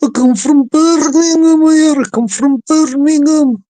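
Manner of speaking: high, loud, hammed-up Brummie accent; Birmingham accent. Shown as a demonstration. Showing an accent he thinks is quite good, broad Birmingham accent. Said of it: "I can do a couple are quite good".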